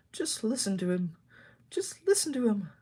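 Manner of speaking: high, light chatter of a coach; twittering. Acted. Delucy the coach twittering about Oliver, lightly high voice. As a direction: twitter it quickly like a gossip. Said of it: "twittered Delucy".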